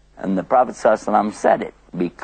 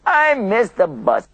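slow, higher mock whine; upset whine; 8 semitones higher, slower than usual. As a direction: drag it out like a sulky complaint. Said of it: "Sometimes people get upset".

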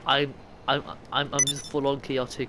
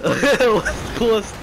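Scottish West Coast, rolled and raised; Scottish accent; 6 semitones higher, 7 dB louder than usual. Shown as a demonstration. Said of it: "I just say Scottish way of coast".

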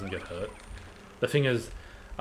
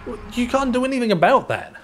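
high mocking voice; 9 dB louder than usual.

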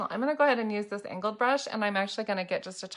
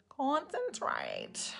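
"Concentrate" voiced, very slow and softer, falling; silly voiced take; 5 semitones higher, 6 dB quieter, much slower than usual. Makeup streamer trying a weird voice, slow and soft. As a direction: draw the word out in a funny voice. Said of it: "that's the kind of voice I, s-, I feel like saying right now".

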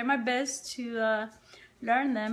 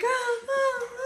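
imitated excited dog; 11 semitones higher than usual. Owner shows how her excited dog goes, high imitation. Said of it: "He's, like, so excited".

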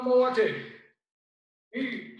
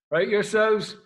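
called out; 8 dB louder than usual. Real, not acted. Sensei calling out to students.

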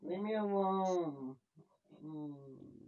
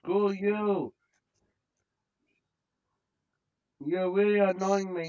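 angry yell; 3 semitones higher, 6 dB louder than usual. Real, not acted. Yelling at game enemy.

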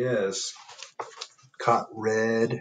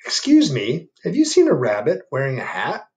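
higher, louder, polite; squirrel voice; 4 semitones higher, 7 dB louder than usual. Acted. Squirrel asking the bear about the rabbit, polite. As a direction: ask politely.

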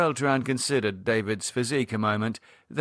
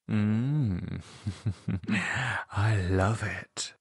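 sensual; much slower than usual. A narrator's reading. Lydia answering David about her dark tastes, sensual. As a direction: savour it slowly, relishing each word. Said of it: "Lydia's reply was sensual".

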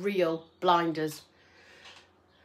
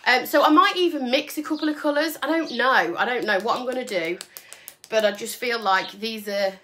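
normal talking voice

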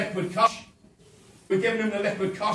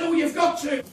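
insistent; 7 semitones higher, 4 dB louder than usual. Retold or imitated. Governor insisting, retold exchange.

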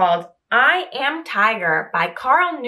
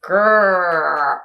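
louder, lower mock tiger growl; 3 semitones lower, 4 dB louder than usual. Mouse growling to prove it is a tiger, louder mock growl. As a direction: growl to sound fierce and big.

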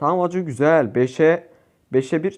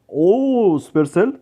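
slow, drawn-out, louder delighted call; pleased surprise; 3 semitones higher, 4 dB louder, much slower than usual. Real, not acted. Gamer thanking the game maker for a prize: drawn-out pleased surprise. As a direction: stretch it in delight. Surprised at mega box reward.

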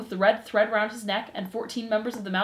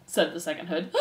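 usual speaking voice

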